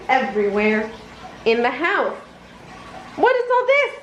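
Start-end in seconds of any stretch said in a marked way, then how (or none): 3.1-3.9 much higher, astonished question; a cartoon yelp of disbelief; shocked cry. A narrator's reading. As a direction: cry out at the muddy mess. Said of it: "cried Mickey".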